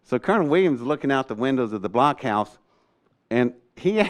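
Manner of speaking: ordinary talk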